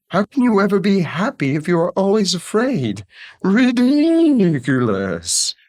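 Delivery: high, scoffing parrot voice; clucking parrot. A narrator's reading. Parrot scoffing at the fearful night creatures, clucking. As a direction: squawk it, stretching the last word out. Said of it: "clucked Papagayo".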